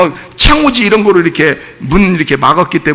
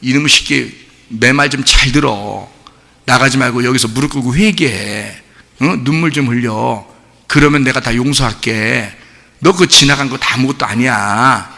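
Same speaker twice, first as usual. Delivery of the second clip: low, softer, forgiving parent voice; parent's true meaning; 6 semitones lower, 4 dB quieter than usual. Acted. Parent privately meaning to forgive child, soft tender pleading. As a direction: speak gently, coaxing the child to repent. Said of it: "그 속뜻을 알아야지".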